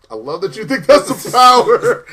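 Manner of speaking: amused condescension. Acted. Girlfriend teases Kite Man about his power, loud amused condescension. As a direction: gush it mockingly through a laugh.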